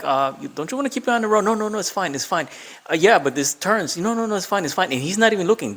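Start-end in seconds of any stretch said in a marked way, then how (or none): 0.0-1.5 nervous passenger. Retold or imitated. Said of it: "I'm like". 1.5-4.9 carefree driver. Retold or imitated. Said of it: "Literally, this is him".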